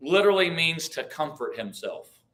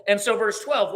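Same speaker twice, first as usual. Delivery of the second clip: ordinary talk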